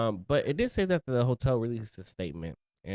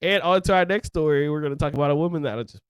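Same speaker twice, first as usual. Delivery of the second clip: host intro, louder and faster, taking over; mock host takeover; 4 semitones higher, 5 dB louder than usual. Co-host hijacking his friend's story intro, mock anchor voice. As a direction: grab the segment like a hammy anchor. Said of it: "Skip over my story completely".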